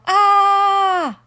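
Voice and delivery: high loud shriek of panic; panicked scream. A narrator's reading. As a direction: scream out in terror.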